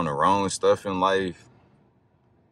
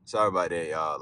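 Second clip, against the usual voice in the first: ordinary talk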